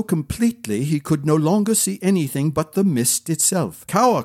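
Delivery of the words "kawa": higher, mildly alarmed call. A narrator's reading. Prince lost in mist calls retainer, mild alarm. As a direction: call out for your servant in alarm. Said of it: "called Brian, alarmed".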